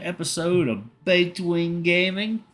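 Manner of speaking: stoned, drifting slurred outro; stoner. Real, not acted. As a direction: talk like you're high and loose. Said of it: "I'm so high right now".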